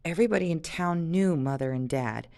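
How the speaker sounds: ordinary delivery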